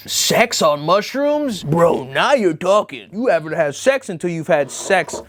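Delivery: slow, put-on bro voice; mock bro ad voice. Mocking the mushroom ad's pitch in a slow bro voice. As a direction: drawl it out like a bro hyping a product. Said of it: "another advertisement for them that says".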